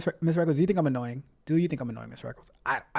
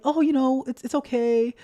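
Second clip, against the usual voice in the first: high, coaxing inner voice; sugary little voice of temptation; sweet little voice; 8 semitones higher than usual. Acted. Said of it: "That little voice can sound so, so sweet".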